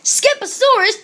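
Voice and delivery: high, loud motherly call; mama declaring. A narrator's reading. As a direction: declare the name like a stern mom. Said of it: "declared Mama Junebug Jones".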